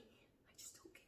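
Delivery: unvoiced whisper, shrugging it off. Real, not acted. Whispering to viewers that she doesn't care. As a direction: whisper it like you mean it.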